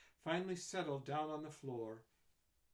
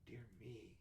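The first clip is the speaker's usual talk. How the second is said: much softer inner thought; thought to herself; 3 semitones lower, 11 dB quieter than usual. A narrator's reading. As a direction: murmur it under the breath. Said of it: "she thought".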